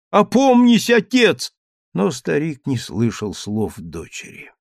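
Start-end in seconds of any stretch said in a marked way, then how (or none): 0.1-1.5 high, loud second plea; desperate scream. A narrator's reading. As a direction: scream it, losing hope. Daughter begs again, rising.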